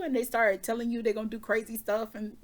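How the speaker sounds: ordinary delivery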